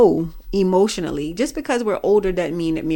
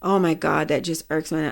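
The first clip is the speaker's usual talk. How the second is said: normal talking voice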